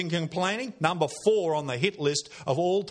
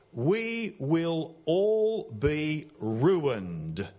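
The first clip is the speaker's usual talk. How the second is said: very slow, every word drawn out separately; slow proper English; much slower than usual. Shown as a demonstration. Preacher introducing a poem's phrase to newcomers, slow proper English. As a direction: say it nice and slowly, fully articulated. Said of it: "I'll say it nice and slowly in proper English".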